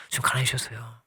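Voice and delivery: whispered awe; hushed whisper. Real, not acted. Preacher whispering to the congregation about Christ's sacrifice. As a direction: drop to a whisper.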